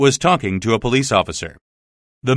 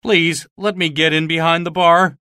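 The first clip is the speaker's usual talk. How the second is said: begging; 7 semitones higher than usual. Acted. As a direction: beg to hide behind the bar. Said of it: "begged Marvel".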